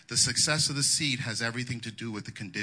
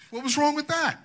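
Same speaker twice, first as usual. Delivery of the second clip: mock puzzled surprise; 4 dB louder than usual. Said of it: "And I'm like".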